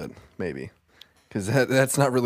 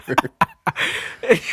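high, breathy burst of laughter; laughing; 7 semitones higher than usual. Real, not acted. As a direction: laugh it out helplessly. Amused by the remark.

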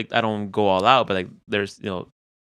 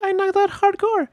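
much higher, sleepy-happy voice; high wife voice. Retold or imitated. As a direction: say it lightly like his wife. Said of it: "She goes".

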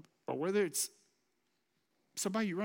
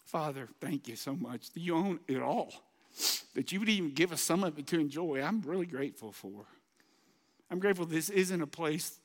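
lower, earnest prayer voice; closing prayer; 4 semitones lower than usual. Acted. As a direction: pray it sincerely over the people.

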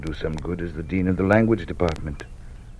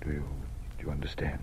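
soft, unvoiced question; hushed whisper; 3 semitones lower, 11 dB quieter, much faster than usual. Dean quietly pressing Anne to grasp the danger. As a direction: whisper it urgently.

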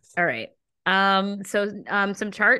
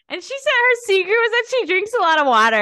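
incredulous; 14 semitones higher, 8 dB louder, faster than usual. Real, not acted. Mocking celebrity's beauty secret.

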